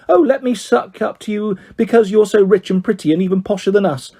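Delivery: high, yucky-sweet fawning impression; Auntie Karen. Girl mocking friend's mother fawning over rich girl, hammed-up gushing. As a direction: suck up in an exaggerated gushing voice. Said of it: "Auntie Karen went all yucky".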